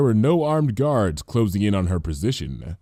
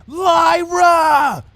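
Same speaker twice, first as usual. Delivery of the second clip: much higher and louder, strained cry; half scream; 7 dB louder than usual. A narrator's reading. Bon Bon trying to get Lyra's attention, half screaming. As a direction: yell it while trying to stay hidden. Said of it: "half whispered, half screamed".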